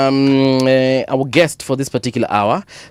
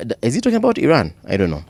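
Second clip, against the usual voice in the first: ordinary talk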